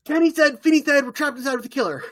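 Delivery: high, loud, breathless panic; mock frantic recap. [name] recapping the trapped gang in a mock frantic squeal. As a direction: blurt it out like a frightened kid.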